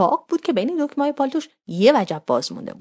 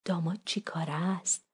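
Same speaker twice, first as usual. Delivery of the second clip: very soft, secretive murmur; hushed aside; 3 semitones lower, 10 dB quieter than usual. A narrator's reading. Daughter asking mother about the groom, hushed. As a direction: drop to a discreet undertone. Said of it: "یواشی به مامان گفتم".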